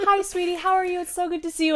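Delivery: high, syrupy sweet hello; sweet high greeting. Retold or imitated. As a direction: gush over him sweetly. Sweet greeting voiced for partner.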